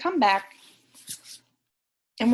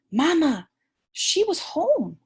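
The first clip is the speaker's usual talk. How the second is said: louder, higher happy call to mama; excited call; 8 semitones higher, 4 dB louder than usual. Acted. Pip calls to mama.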